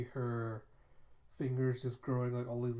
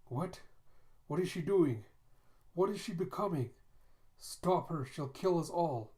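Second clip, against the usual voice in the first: higher, louder alarmed questions; panicked cry; 5 semitones higher, 3 dB louder than usual. Retold or imitated. Onlookers watching her transform: higher, louder panicked pleading. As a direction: panic as she changes.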